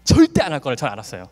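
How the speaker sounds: usual speaking voice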